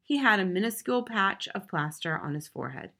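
plain reading voice

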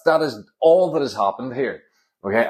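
ordinary delivery

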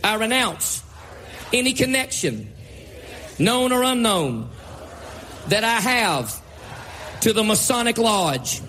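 slow, emphatic preacher's declaration; slow renunciation prayer. Acted. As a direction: declare each phrase slowly with pauses. Leads congregation in renunciation prayer.

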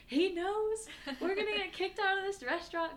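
high, loud mock panic; panicked. Friends fearing they'll get kicked out, panicked. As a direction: squeal it like you've been found out. Retold panic at being found out.

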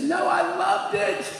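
very high imitated tearful wail; high-pitched mock crying voice; weeping child. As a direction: act out a girl protesting through tears. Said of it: "And she's weeping".

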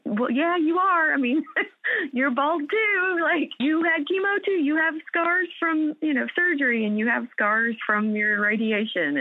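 high, giggly voice telling herself off; mock scolding herself. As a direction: talk back to your own doubts like a teasing friend. Answering her own inner doubts.

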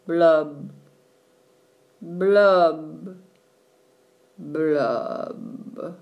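slow sinking blub sounds; blub blub bubbles. A narrator's reading.

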